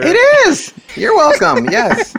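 very high, silly squeak; squeaky high voice. Pretend. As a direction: answer in a cartoon falsetto. Joking reply in squeaky voice.

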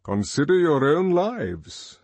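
slow, loud, weighty address; Mustafa Mond commanding. A narrator's reading. World controller addressing the boys, slow and loud. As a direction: lay it down slowly like a decree. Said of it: "said Mustafa Mond".

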